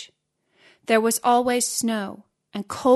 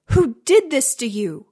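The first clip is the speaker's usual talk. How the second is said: loud, high, outraged question; angry; 4 semitones higher, 6 dB louder than usual. A narrator's reading. Giant seeing the hurt boy, angrily demanding who did it. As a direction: demand to know who is responsible. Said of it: "asked the giant angrily".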